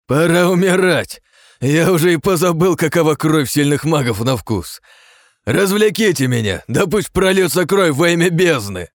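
high, loud, crazed roar; mad battle frenzy. A narrator's reading. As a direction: howl it in bloodthirsty glee. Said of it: "Всё так же безумно смеясь".

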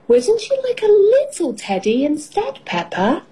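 high-pitched, sweetly pleading question; coaxing mother. A narrator's reading. Mother coaxes child in story.

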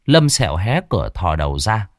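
ordinary delivery

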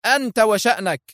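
high-pitched, edgy retort; irritable. A narrator's reading. Woman brushes off the officer's question, irritable. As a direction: toss it back irritably. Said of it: "قالت في عصبية".

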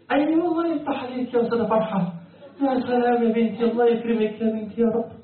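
high-pitched, effusive blessing; thrilled teacher's congratulation; delighted praise. Real, not acted. Teacher praises a student's answer.

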